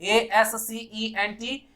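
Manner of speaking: letters of एएससीईएनटी, one by one; spelled out slowly. Shown as a demonstration. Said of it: "accent ये होता है".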